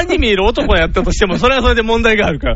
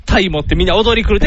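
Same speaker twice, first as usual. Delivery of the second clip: normal talking voice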